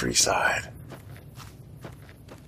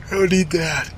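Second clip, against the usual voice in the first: talking through a yawn; 5 semitones higher than usual. Real, not acted. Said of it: "Haven't yawned in".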